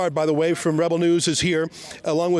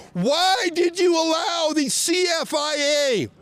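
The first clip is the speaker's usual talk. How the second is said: shouting at coppers; 8 semitones higher than usual. Real, not acted. Shouting at police.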